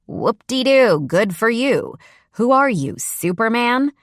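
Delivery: mock being impressed; sarcastic. A narrator's reading. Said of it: "I deserve this cold treatment from you".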